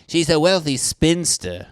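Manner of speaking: high, loud affected reading; mock-grand recital. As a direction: recite it with exaggerated pomp. Mockingly reciting a book description.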